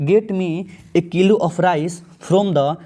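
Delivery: very slow, word by word; slow dictation of the exam sentence; slow read-aloud. Shown as a demonstration. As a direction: spell the sentence out slowly for students. Teacher reading exam sentence slowly.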